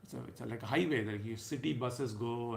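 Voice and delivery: ordinary talk, nothing marked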